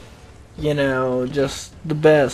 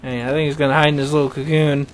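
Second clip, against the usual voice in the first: ordinary delivery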